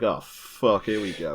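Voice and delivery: low, softening mock resignation; resigned dismay. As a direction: sink into a weary "here we go again". Firemen's dismay, acted out.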